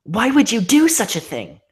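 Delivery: outraged heroine. Acted. Twilight confronting the monster.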